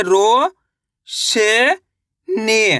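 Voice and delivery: each syllable said slowly and apart. Shown as a demonstration. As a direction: sound out each syllable with pauses between. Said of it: "तो direct पढ़ेंगे कैसे?".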